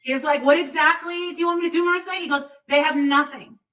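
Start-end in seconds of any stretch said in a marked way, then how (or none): none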